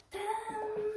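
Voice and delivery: high sung-out reveal; Tadam enjoué. Real, not acted. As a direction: announce it like a magic trick. Showing full outfit. (révélation enjouée et aiguë)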